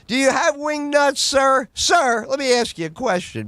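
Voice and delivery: yelling at a stranger about wing nuts; shouted demand. Retold or imitated. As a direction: bark it at him like an interrogation. Badgering question, repeated.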